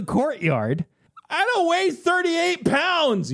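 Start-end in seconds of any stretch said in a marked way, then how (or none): 1.3-3.3 slow, high-pitched cry of protest; indignant storybook yell, pitched way up. A narrator's reading. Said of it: "you yell".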